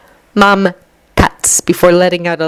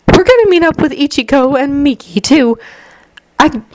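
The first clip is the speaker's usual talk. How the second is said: higher, cheerful mom voice; brisk friendly mother; 9 semitones higher than usual. A narrator's reading. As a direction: sound like a fond, busy mom.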